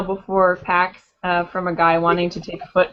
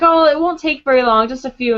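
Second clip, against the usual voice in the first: higher, louder retold wheedling; imitated coaxing; 5 semitones higher, 5 dB louder than usual. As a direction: mimic a guy pressing for a photo. Guy coaxing them into photos.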